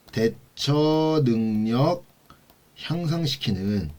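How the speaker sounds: very slow, stretched-out key term; drawn-out dictation. Real, not acted. Lecturer spelling out a key term for students, drawn-out dictation. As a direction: draw each syllable out for note-takers.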